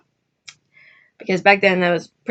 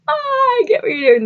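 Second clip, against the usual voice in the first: high, louder dawning realization; the penny drops; sudden realization; 5 dB louder than usual. Retold or imitated. Soldier realizing his buddy's trick, high excited realization.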